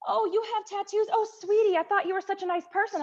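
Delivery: high, sugary mock of a disapproving lady; patronizing mock. A stranger clucking over her tattoos, high and sugary mock. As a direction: sweet-talk her like a disappointed auntie. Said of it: "And then I get told".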